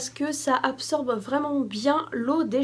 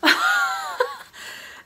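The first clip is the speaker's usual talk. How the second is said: high squealing giggle; a breathless high squeal of a laugh; delighted laugh. Real, not acted. Delighted unboxing a cute item.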